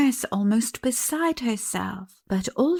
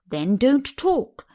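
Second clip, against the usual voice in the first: slow, louder, higher curt nurse; curt reply; 4 semitones higher, 6 dB louder, slower than usual. Acted. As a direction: cut her off sharply, slowly. Nurse snapping while running.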